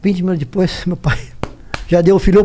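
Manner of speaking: ordinary delivery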